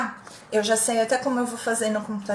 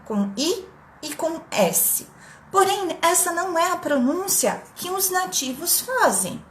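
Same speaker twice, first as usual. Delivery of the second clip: ordinary talk, nothing marked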